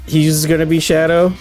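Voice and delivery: usual speaking voice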